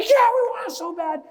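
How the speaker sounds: very high, loud mock cheer; hammed-up enthusiasm, pitch way up; acted eager crowd. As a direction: answer as a group desperate to start. Eager group answering.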